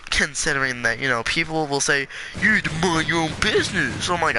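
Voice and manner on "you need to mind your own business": put-on mocking voice; hammed-up imitation of a scold. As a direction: parrot a busybody's telling-off. Mocking what people will say.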